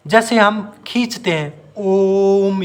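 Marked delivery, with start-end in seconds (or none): 1.8-2.6 ओम with the ओ held long and slow; drawn-out om. Shown as a demonstration. Teacher demonstrating a stretched vowel, long ओम. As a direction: chant ओम, stretching the ओ. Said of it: "ओ को खींचते हैं".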